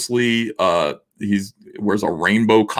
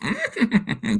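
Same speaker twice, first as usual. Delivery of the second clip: high squeaky mock giggle; imitating doll giggle; 13 semitones higher, 4 dB quieter than usual. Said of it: "it goes".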